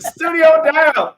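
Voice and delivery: laughing it out, slow; cracking up over the words; loud laughing. Real, not acted. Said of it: "laughing at studio going down".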